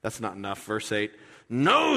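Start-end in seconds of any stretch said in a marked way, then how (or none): 1.5-2.0 a loud, high, indignant no; Peter refusing. Retold or imitated. Peter refusing Jesus at the foot washing, high indignant shout. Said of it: "says Peter".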